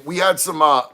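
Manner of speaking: usual speaking voice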